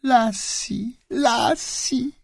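very high, soft, thin cry of joy; joyful squeal. Acted. Boy spotting his dog at the school gate, squealing with joy. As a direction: squeal the dog's name, rising. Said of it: "pisnął z radości".

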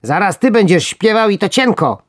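high, loud worm threat; threatening. Acted. First worm threatens his sleepy friend. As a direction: warn him sharply. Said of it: "zagroził pierwszy robak".

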